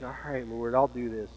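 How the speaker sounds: low, soft resigned giving in; subdued, acted resignation; reluctant acceptance. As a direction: quietly give in, a touch reluctant. Voicing woman reluctantly giving in to God.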